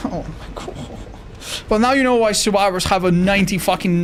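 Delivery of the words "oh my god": breathed under the breath; exasperated whisper. Real, not acted. Streamer whispering in disbelief at hopeless teammates. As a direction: sigh it out without voice.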